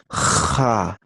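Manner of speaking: "Ja" with a long rasped fricative J; scraped J. Shown as a demonstration. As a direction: scrape the throat on the J of "Ja". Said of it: "pero raspada".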